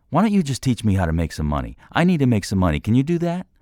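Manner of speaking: fast, rising challenge, high and loud on the end; out of line. A narrator's reading. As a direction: demand it, knowing you overstep. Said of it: "half knowing he was out of line".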